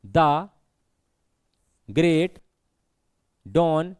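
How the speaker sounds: "द", "great", "डॉन" each read alone with long pauses; word-by-word reading. Shown as a demonstration. Teacher reads the line for students to count syllables, word-by-word "द", "great", "डॉन". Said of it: "पढ़ें अपन इसको".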